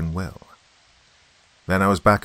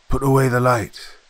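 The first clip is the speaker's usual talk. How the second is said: loud, higher command across water; raised, carrying order; calling from afar; 6 semitones higher, 6 dB louder than usual. A narrator's reading. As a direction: call back urgently from a distance. Distant stranger calls back from boat.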